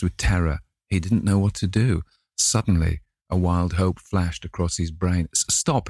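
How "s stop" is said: cried. A narrator's reading. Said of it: "he cried".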